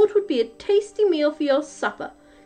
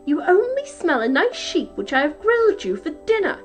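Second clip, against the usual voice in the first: higher-pitched dismissive wife; scolding wife; 6 semitones higher, 4 dB louder than usual. A narrator's reading. Wife explaining dinner to her giant husband, high-pitched impatience. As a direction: put him right in a high, impatient voice.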